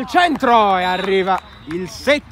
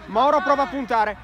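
ordinary delivery

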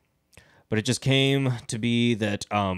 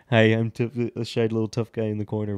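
lightly mocking shy little voice; shy little tough guy. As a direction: imitate a timid speaker hiding in the corner. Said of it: "You got your, like ... voice".

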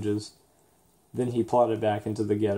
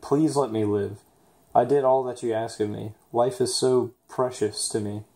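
usual speaking voice

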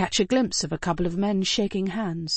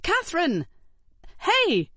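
high, urgent shout of her name; calling out; 11 semitones higher than usual. Acted. Passenger calling to friend passing outside window. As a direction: call her back desperately.

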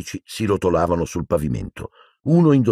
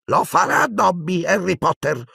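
Dobby, squeaking; 13 semitones higher than usual. Acted. Tearful house-elf volunteers to Harry Potter, squeaking. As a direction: squeak an ardent offer of service. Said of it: "squittì Dobby".

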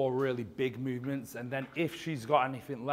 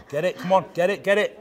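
shouting encouragement; 6 semitones higher, 9 dB louder than usual. Real, not acted. Coach yelling at client's set.

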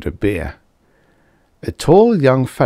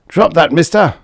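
sharp, louder, higher barked command; snapped order; 9 semitones higher, 6 dB louder than usual. A narrator's reading. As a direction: bark it at the recruit. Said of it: "Karsh snapped".

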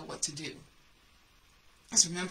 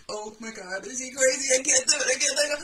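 rising to a high, very loud panicked cry; half-screamed terror at the top of the pole; 7 semitones higher, 9 dB louder than usual. Retold or imitated. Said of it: "And I'm like".

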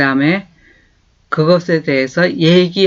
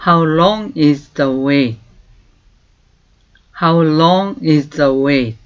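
very slow, clear English for learners to copy; slow model phrase; much slower than usual. Shown as a demonstration. Teacher slowly modelling a question. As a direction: stretch each word for repeating. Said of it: "teacher models the phrase".

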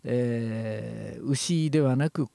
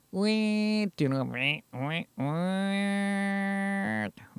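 trembling sheep bleat; 5 semitones higher, 4 dB quieter, slower than usual. Shown as a demonstration. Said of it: "めーに近づけていく".

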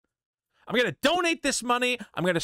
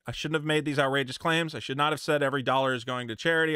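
ordinary talk, nothing marked

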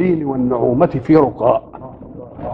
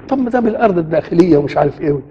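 usual speaking voice

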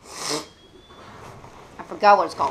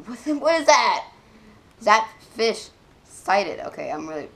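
ordinary delivery

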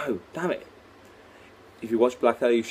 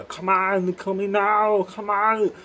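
put-on higher, louder Austrian-accented call; loud, high Austrian-accent Arnold call; Arnold shouting; 10 semitones higher, 4 dB louder, much slower than usual. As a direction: yell it like the Terminator urging you in. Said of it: "I am Arnold Schwarzenegger".

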